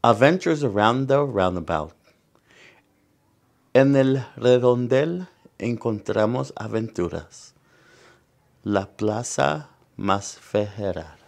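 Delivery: lively bilingual picture-book reading; reading to children. Acted. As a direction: read it out to the kids, bright and clear. Reads picture book aloud.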